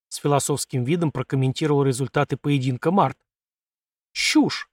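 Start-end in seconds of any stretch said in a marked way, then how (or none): 4.1-4.7 higher, scornful one-word dismissal; dismissive snort. Acted. Sasha waving away a friend's philosophical verdict, dismissive snort. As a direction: snort it as a put-down. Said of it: "фыркнул Саша".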